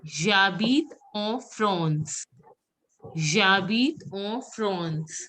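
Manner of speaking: J'habite en France said very slowly, France as "frons"; slow model of J'habite en France, word by word; pronunciation model. Shown as a demonstration. Teacher models "I live in France" for students, slow careful J'habite en France. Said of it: "how will you pronounce".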